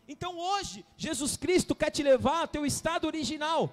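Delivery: loud, building preaching ending in shouts; full-force preaching. Acted. Pastor proclaiming healing to the congregation, loud full-force preaching.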